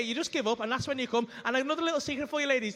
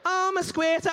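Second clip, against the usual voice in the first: mocking; 6 semitones higher, 3 dB louder than usual. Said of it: "mocking quote".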